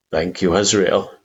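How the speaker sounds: ordinary delivery, nothing marked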